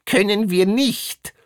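very high-pitched refusal; unrelenting. A narrator's reading. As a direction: refuse flatly in a piercing high voice. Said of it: "Zizi Warek war unerbittlich".

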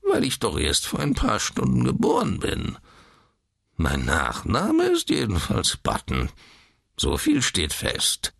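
put-on a touch of tearful old man's whine; mildly whiny, opening high. A narrator's reading. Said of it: "die weinerliche Quengelstimme". (leicht weinerliche Quengelstimme)

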